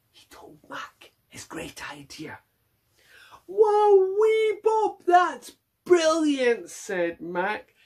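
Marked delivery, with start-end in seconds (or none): 0.1-2.4 whisper. A narrator's reading. Secret idea told. 3.5-6.7 high, loud delighted cry; bursting with delight; excited. Acted. Mac delighted.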